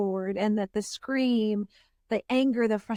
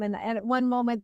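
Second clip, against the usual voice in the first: ordinary delivery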